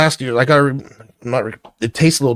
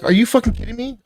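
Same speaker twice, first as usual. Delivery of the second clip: high incredulous outburst; retold disbelief; 10 semitones higher, faster than usual. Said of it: "I'm like".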